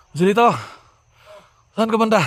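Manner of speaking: high urgent shout across the field; calling out. Real, not acted. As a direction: yell the name to find him. Searching for wounded teammate.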